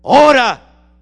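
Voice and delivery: booming, high shouted command; powerful divine voice. Retold or imitated. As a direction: thunder out God's order. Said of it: "una voz poderosa que me dijo". (voz poderosa, gritando una orden)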